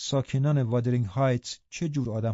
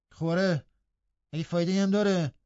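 higher rustic lad's grumble; grumbling; 5 semitones higher than usual. A narrator's reading. Lad with no ready answer to his housemate, grumbling. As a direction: grouse back, raised in pitch. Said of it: "با قرولند گفت".

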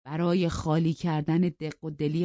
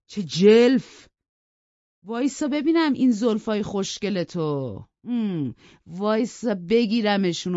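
mocking bully; 6 semitones higher, 7 dB louder than usual. Hindley taunts Heathcliff about his hair, loud and sneering. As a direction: jeer at him and drag out the threat.

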